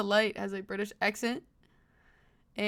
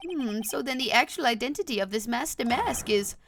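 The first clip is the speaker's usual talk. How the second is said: puzzled judge; 4 semitones higher than usual. Acted. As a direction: play a flustered courtroom judge. Plays the game's judge.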